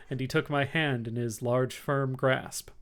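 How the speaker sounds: ordinary delivery, nothing marked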